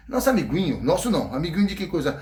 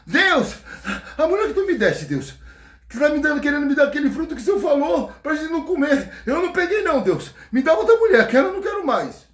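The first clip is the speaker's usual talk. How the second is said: panicked Adão; 8 semitones higher than usual. Acted. Adão runs through the garden whining to God about Eva. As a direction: run to God tattling on the woman. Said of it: "sai correndo pelo jardim falando".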